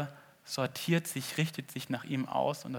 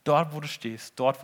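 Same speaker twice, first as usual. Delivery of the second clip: usual speaking voice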